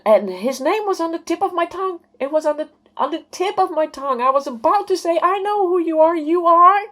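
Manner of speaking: very high, sustained, building to loud; breathless climb into an overwhelmed cry; urgent near-recognition. Retold or imitated. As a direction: strain toward the name at a high held pitch. Reliving name on tip of tongue.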